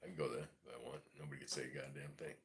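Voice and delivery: ordinary delivery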